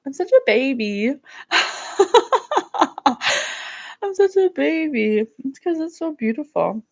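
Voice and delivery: choked up and laughing through the tears; tearing up. Real, not acted. Host to her viewers, overcome while recalling hugging her inner child, tearful laugh. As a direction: voice breaking high into a teary laugh. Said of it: "Oh, I'm gonna cry thinking about it".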